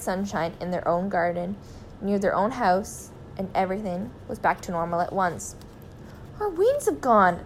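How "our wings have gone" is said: high, amazed exclamation; delighted cry. A narrator's reading. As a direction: cry out the discovery in a raised voice. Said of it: "cried Mister Gregg".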